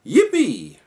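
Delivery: high, loud squeal of joy; a high, loud joyful yippee; excited yippee. A narrator's reading. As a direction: squeal it like a thrilled cat. Cat cheers for honey sticks.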